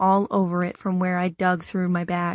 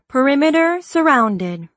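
higher, louder automated alert; car warning voice; 6 semitones higher, 6 dB louder than usual. Acted. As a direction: announce it like a car alarm system. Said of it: "the car voice says".

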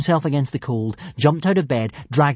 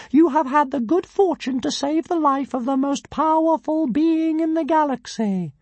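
pompous tiny dragon; 3 dB louder than usual. A narrator's reading. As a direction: announce yourself like a pint-sized deity.

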